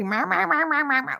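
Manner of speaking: high, rapid-fire chattering meows; mock cat meows. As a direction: imitate a nagging, talkative cat. Imitating her vocal cat.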